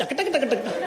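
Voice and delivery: rapid clacking percussion noises; dholak and spoon beat. Retold or imitated. Comedian imitating folk music percussion, rhythmic clacking. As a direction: imitate a spoon tapping on a drum. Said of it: "ढोलक और चम्मच".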